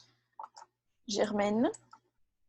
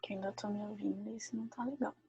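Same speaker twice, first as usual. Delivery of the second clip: hushed, barely voiced aside to herself; whispered aside; 16 dB quieter, faster than usual. Real, not acted. Teacher whispering to herself about the audio. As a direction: whisper it off-mic, annoyed at the sound setup.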